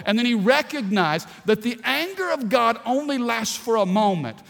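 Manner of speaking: loud, rising preacher's delivery; preaching. Acted. Pastor urging the church to leave sadness, loud preaching. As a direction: preach it with fire to the congregation.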